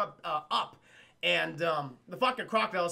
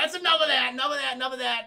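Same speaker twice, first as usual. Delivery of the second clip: mock scolding; 5 semitones higher, 5 dB louder, faster than usual. [name] breaking up party.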